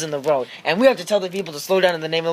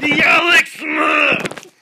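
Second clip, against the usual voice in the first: mock announcement; 7 semitones higher, slower than usual. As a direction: boom it out like a big reveal. Announcing a name grandly.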